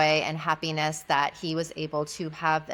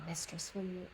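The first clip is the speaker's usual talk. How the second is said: barely audible, much softer; near whisper; 16 dB quieter than usual. Retold or imitated. Client asking his mistress, near whisper. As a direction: force the words out in a hush. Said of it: "like, almost like a whisper".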